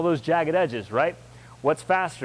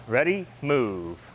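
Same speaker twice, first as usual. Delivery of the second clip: slow, drawn-out command to begin; drill command; 4 semitones lower, much slower than usual. Real, not acted. Instructor starting the class on rising knee, drill command. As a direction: stretch out the call to move.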